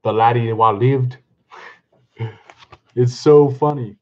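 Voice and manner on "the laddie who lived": lightly attempted Scottish accent; amateur Scottish accent; Scots reading. Shown as a demonstration. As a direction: read it with a hint of Scottish.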